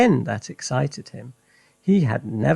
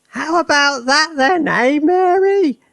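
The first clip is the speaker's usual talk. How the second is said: put-on high, loud old woman's cry; triumphant Grandma, high and loud; Grandma shouting; 6 dB louder than usual. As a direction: crow it down from the rooftop at Mary. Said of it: "Grandma shouted".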